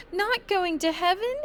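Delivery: high, stricken disbelief; mock devastation. A narrator's reading. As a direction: act utterly crushed. Child devastated.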